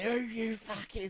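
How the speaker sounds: a hint of a sneering drunk passenger; mock drunk woman.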